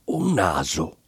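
low, hushed, slow reveal; quiet dread. A narrator's reading. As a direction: let the word drop, stunned and soft. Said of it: "cavò fuori". (sottovoce, cupo e stupefatto)

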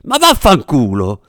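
slow, punched curse; furious outburst. A narrator's reading. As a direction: spit the insult out in exasperation. Doctor furious at the fake props. (imprecazione rabbiosa, scandita)

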